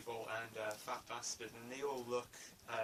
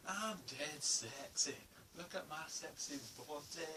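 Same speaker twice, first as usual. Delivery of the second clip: slow, higher self-admiring Scottish drawl; Fat Bastard impression; 4 semitones higher than usual. Fan quoting Fat Bastard in bed in a hammed-up Scottish voice. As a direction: purr it out in a hammed-up Scottish accent. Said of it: "he's like".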